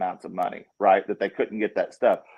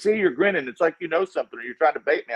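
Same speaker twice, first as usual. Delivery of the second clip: higher, half-mocking imitation of the official; suspicious official; 7 semitones higher, faster than usual. Retelling the official's suspicion, voiced higher and mocking. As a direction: play her as wary and accusing. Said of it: "and she goes".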